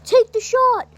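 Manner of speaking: high-pitched urgent yell; yelled order. A narrator's reading. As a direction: yell the command across to him. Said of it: "Alden yells at him".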